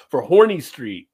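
"Horny" said slowly, stretched out; "Horny Street" drawn out and loud. Shown as a demonstration. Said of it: "it's pronounced horny down there".

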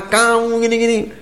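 high-pitched, mildly angry mock scolding. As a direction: act out someone losing their temper, without shouting. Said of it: "Dia marah, habis marah".

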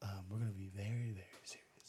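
low, mock-serious whisper; hushed aside played for laughs. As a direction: whisper it, fading almost to nothing. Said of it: "See, he started whispering".

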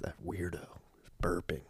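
under-the-breath whisper; whispered aside. Real, not acted. Muttered aside about burping.

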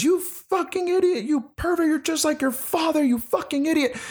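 high-pitched mock rage at the boy; imitating furious mother. Mother in the movie berating her son, high mock fury.

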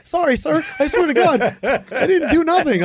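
high, loud panicked plea, half laughing; mock pleading. Passenger protesting innocence to the manager, in mock high-pitched pleading. Imitates herself pleading with official.

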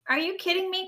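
high-pitched disbelief; incredulous cry. A narrator's reading. Rabbit can't believe the long joke, crying out. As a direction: cry out in indignant amazement. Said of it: "cried Rabbit".

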